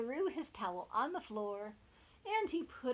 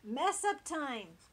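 big announcement; 6 semitones higher, 9 dB louder, slower than usual. A narrator's reading. Announces dreaded mess time.

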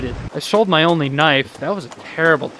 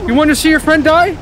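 high, loud taunt; loud mock threat; 10 semitones higher, 5 dB louder than usual. Player taunts enemy about his friend, loud threat. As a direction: threaten them in play.

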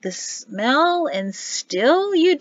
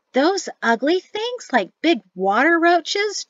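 much higher, slow disgusted cry; exclaimed; 8 semitones higher, slower than usual. Acted. Jatesy hears crawdads are on the menu, shocked exclamation. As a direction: recoil at the very idea. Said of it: "Jatesy exclaimed".

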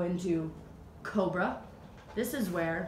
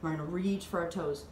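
ordinary talk

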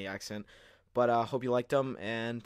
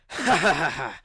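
evil laugh; 6 semitones higher, 10 dB louder than usual. Acted. Villain Tiger Claw laughing.

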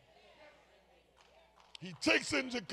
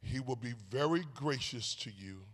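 holding back tears; 9 semitones lower, 7 dB quieter than usual. Real, not acted. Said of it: "I could even read this without crying".

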